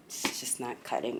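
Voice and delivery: whispered aside, disappointed. Real, not acted. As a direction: drop to a whisper. Disappointed about hair.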